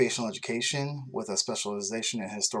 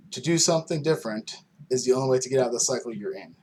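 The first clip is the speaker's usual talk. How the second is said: earnest book reading, slightly raised voice; reading aloud; 3 dB louder than usual. Acted. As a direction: read it like you're sharing something personal in earnest. Reads his book's dedication aloud.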